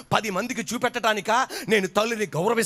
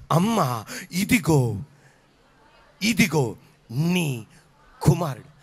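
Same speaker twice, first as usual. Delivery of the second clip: lower, very slow, drawn-out words from the cross; tender dying farewell; 5 semitones lower, slower than usual. Acted. Jesus on the cross speaking to his mother, slow and low. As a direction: let each word fall slowly and heavily, lower than usual.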